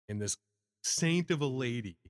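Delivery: ordinary delivery, nothing marked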